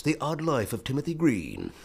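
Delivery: trailer voice in the style of Hal Douglas; Hal Douglas impression. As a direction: intone it like a movie trailer. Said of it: "the Hal Douglas".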